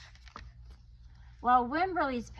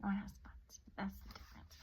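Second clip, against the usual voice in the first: ordinary delivery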